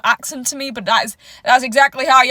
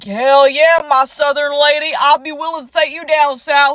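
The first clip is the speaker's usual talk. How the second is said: southern American man; 4 dB louder than usual. Retold or imitated. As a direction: play a brash Southern flirt in a mild American accent. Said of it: "They're like".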